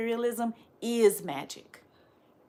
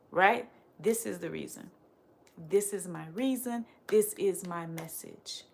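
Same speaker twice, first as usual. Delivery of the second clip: ordinary talk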